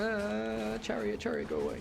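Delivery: high, alarmed call to the chariot; startled. Real, not acted. Gamer startled by an enemy chariot, high alarm. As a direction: shoo the chariot away in a panic.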